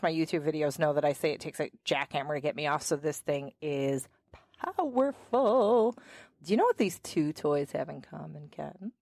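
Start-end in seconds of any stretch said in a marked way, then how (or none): 4.6-6.0 POWERFUL stretched out slowly; milk the word powerful; powerful, slow and weighty; drawn-out emphasis. Real, not acted. Hyping the toy as powerful.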